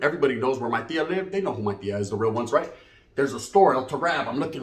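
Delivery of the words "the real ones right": ordinary talk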